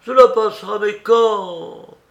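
high, loud incredulous echo; exasperated. Acted. Aunt rejecting her niece's reassurance, exasperated cry. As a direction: fling the words back, loud and high. Aunt throws the words back.

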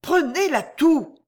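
high, drawn-out call across water; stifled call. A narrator's reading. Girl calls across the pond through cupped hands, stifled call. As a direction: call over, holding the voice back. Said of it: "cria, en étouffant sa voix".

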